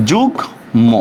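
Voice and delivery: যুগ্ম split as jug, then mo; syllable-by-syllable pronunciation. Shown as a demonstration. Teacher showing students the pronunciation, যুগ্ম split into jug and mo. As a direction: break যুগ্ম in two, sounding the m. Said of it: "এটার উচ্চারণটা হচ্ছে যুগ্ম".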